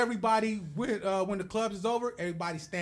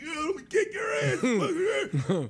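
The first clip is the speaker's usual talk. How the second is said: slow, higher, laughing mock threat; 9 semitones higher, much slower than usual. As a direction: ham up a drunk guy's dare through laughter. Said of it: "he take a sip and look at me".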